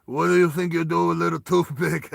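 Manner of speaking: big gnarly Russian guy; Russian accent. Acted. Scarred Russian leans over to Roy, gnarly Russian accent. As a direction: taunt in a thick Russian accent. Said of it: "This big, dirty, gnarly guy".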